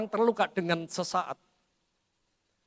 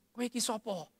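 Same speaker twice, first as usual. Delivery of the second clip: inner retort; 2 semitones higher, 9 dB quieter than usual. Retold or imitated. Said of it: "Saya dalam hati gini".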